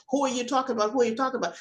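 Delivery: normal talking voice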